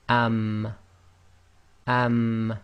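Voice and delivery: "am" with the dry part of the lips pressed shut; Arabic am with sukun. Shown as a demonstration. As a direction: close the lips fully on "am" and hum. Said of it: "dry portion of your lips touching".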